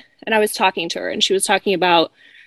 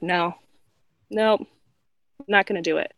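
low, soft, with pauses between refusals; quiet firm refusal; 4 semitones lower, 6 dB quieter than usual. Retold or imitated. Hairdresser taking her power back, quiet retold refusal. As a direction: refuse quietly and leave it there.

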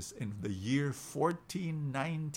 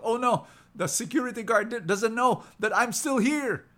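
shocked panic; 9 semitones higher, 6 dB louder than usual. Acted. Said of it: "the tourist was shocked".

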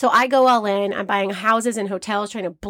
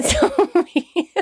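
breaking into laughter; laughing; 10 semitones higher than usual. Real, not acted. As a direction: giggle through the words. Laughing while telling Monopoly story.

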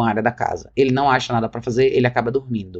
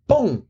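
mock impact noise; 11 semitones higher than usual. Mimicking throwing the bonker.

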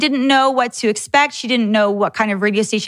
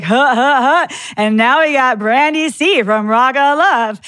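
douchey radio DJ. Retold or imitated. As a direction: ham up a sleazy morning-show host leering into the mic. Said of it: "those super douchey morning show guys are like".

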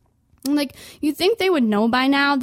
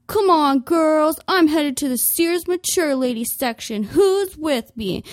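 higher, louder triumphant rally; rallying cry; 5 semitones higher, 4 dB louder than usual. Retold or imitated. Customer rallying the applauding shoppers, higher and triumphant. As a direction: lead the crowd out. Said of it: "I'd be like".

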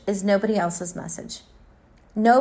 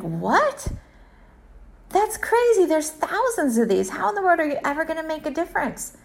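pitched way up, quick and incredulous; incredulous disbelief; 6 semitones higher than usual. Retold or imitated. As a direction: scoff at the boy as if he's lost his mind. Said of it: "And you're like".

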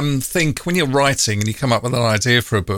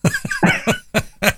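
high laugh. Real, not acted. Host laughs at the punchline.

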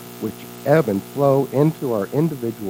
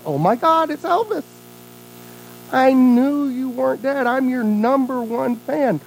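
high, breathless-with-joy fan voice; gushing superfan; 9 semitones higher than usual. Acted. Hotel clerk welcomes her idol back, gushing. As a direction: gush over your idol, stretching the words.

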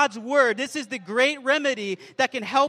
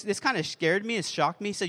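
ordinary talk, nothing marked

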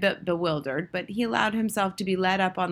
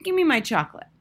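raised-pitch bratty demand; pushy, higher boy's voice; 7 semitones higher, 4 dB louder, faster than usual. A narrator's reading. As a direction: insist like a spoilt child. Boy demands his chocolate.

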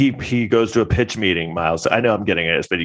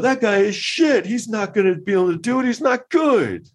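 scornful exec; 14 semitones higher than usual. Retold or imitated. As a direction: sneer at Coppola like a snobby exec. Mocking studio exec dismissing Coppola.

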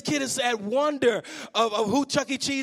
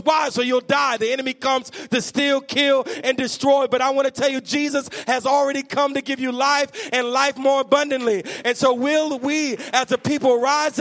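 full-force preaching; 3 semitones higher, 3 dB louder than usual. Acted. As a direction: preach it to the rafters. Preaching climax.

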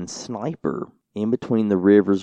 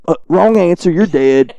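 higher, louder mock verdict in a Louisiana accent; Cajun accent; 9 semitones higher, 9 dB louder than usual. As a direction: deliver the lady's blunt verdict in Cajun accent. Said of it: "a very strange accent".

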